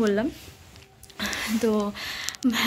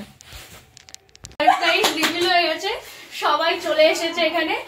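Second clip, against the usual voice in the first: excited and laughing; 8 semitones higher than usual. Real, not acted. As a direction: burst out with glee now the secret's out. Surprise revealed, teasing mother. (হাসতে হাসতে উচ্ছ্বসিত গলায়)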